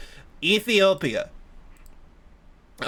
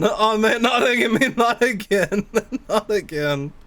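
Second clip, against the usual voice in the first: resigned laughing; 4 semitones higher, slower than usual. Real, not acted.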